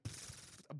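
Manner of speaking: soft airy "pfft" puff; small mind-blown sound effect; quiet puff of air through the lips; mimed explosion noise. Retold or imitated. Imitates mind being blown.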